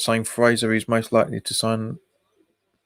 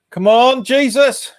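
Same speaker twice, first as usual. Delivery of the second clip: frustrated yell; 11 semitones higher, 6 dB louder, slower than usual. Real, not acted. Frustrated fan yelling at match.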